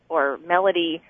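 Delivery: usual speaking voice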